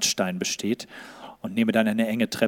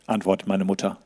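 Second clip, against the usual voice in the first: ordinary delivery, nothing marked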